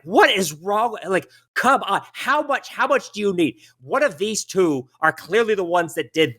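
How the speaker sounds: high-pitched exasperated halfling; fussy little man in a fluster; shrill, annoyed. Acted. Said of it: "a very shrill, annoyed voice".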